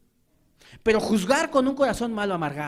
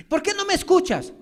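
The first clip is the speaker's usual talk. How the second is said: high, accusing question; mocking reproach; 10 semitones higher, 4 dB louder than usual. Preacher mimicking a spouse's reproach, high and accusing. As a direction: throw the complaint out, much higher. Mock reproach, then echo.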